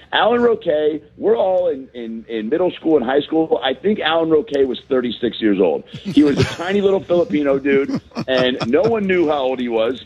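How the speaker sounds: usual speaking voice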